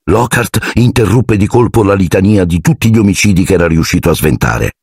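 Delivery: ordinary delivery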